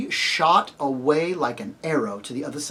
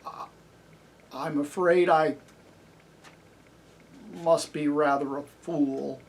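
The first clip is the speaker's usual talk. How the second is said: shaken voice. A narrator's reading. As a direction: confess haltingly, shaken. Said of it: "said Bree in a shaken voice".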